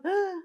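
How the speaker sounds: inhalación aguda y ahogada; jadeo imitado. Retold or imitated. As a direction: imitar una opresión por dentro tomando aire de golpe. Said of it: "era una cosa acá adentro". (jadeo ahogado imitado)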